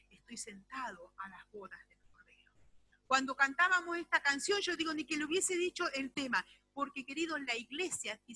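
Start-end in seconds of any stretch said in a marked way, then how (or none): 0.1-2.5 hushed whisper of longing; soft reverent whisper. Acted. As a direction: breathe it like a secret. Whispered quote of the church.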